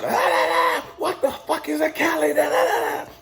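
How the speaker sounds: high, squawking mock rant; imitating a yelling commander. Commander erupts at a recruit from Cali, mock yelling. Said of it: "he's just yelling".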